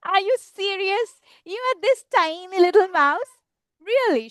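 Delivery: amused storybook disbelief; Gruffalo. Acted. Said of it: "And Gruffalo says".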